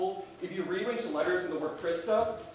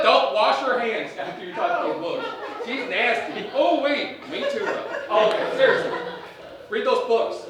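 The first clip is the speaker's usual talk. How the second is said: hammed-up Southern principal; 2 semitones higher, 8 dB louder than usual. Principal character held through sketch.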